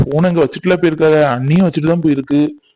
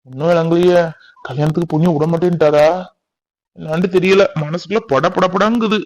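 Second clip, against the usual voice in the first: ordinary talk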